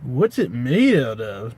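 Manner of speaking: very slow, spaced-out stoner drawl. Retold or imitated. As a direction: drag it out like a dazed stoner. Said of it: "that accent was".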